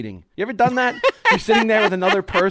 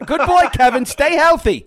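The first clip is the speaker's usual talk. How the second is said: put-on higher, louder good boy; mock Russo; 9 semitones higher, 4 dB louder than usual. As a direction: pat him on the head like Dog. Imitating Russo to old guest.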